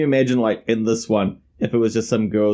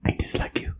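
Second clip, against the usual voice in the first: whisper yelling; 8 dB quieter than usual. Retold or imitated. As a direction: yell it under your breath, no voice. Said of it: "still sort of whisper yelling".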